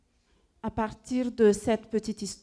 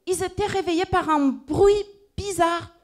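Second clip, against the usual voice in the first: ordinary delivery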